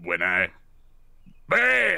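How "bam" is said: high yelled bam; high excited shout. Acted. Character launching into the air.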